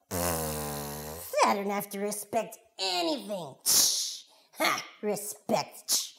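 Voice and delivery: slow, scoffing defiance; drawn-out dismissive grumble; scornful little boy. Acted. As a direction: scoff at the rule with huffs. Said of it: "that little boy, he's like".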